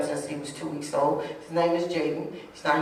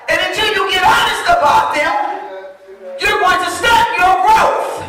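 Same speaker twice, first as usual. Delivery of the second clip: high, loud, driving sermon delivery; fired-up preacher, voice raised; full-force preaching; 12 semitones higher, 10 dB louder than usual. Acted.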